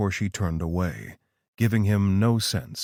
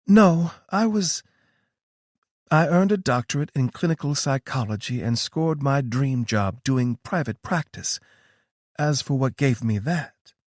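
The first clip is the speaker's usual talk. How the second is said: higher, knowing female voice; a knowing, higher-pitched Tara; Tara's voice; 6 semitones higher than usual. A narrator's reading. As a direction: play Tara, calm and sure of herself.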